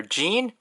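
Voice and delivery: Jean as one syllable with long ee; "Jean" with an ee sound; chosen pronunciation. Shown as a demonstration. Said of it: "I stick with Jean".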